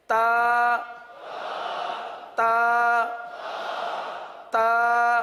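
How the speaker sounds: تا loud and held, tongue tip on the upper tooth roots; loud drawn-out تا from the tongue tip; model recitation. Shown as a demonstration. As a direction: call out تا like a Qaida teacher. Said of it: "اگلا حرف".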